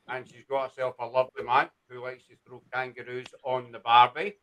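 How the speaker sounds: put-on light Australian accent, casual; a hint of Aussie accent. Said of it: "my wee Australian accent".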